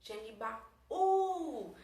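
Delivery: pause, then hold the "ú" of "Genibaú"; drawn-out emphatic "Genibaú"; stretched final syllable. Shown as a demonstration. Teacher repeats the syllable split.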